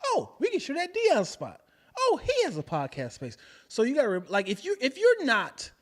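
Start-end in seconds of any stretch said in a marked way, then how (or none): 0.1-3.3 high, eager "oh!" of remembering; acted sudden realisation. Voicing a customer reminded by advertising, acted excited realisation. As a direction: act out someone suddenly remembering who to hire.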